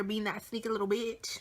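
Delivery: ordinary talk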